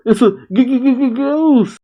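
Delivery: high-pitched stuttering fake fright; mock-terrified stammer. Mock fright at a supposed ghost.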